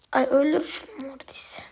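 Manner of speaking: tense whispered plea; whispered urging during a fight. Real, not acted. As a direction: hiss it under your breath, urging a teammate. Tense moment in a match.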